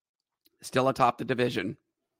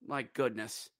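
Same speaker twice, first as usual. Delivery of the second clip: ordinary delivery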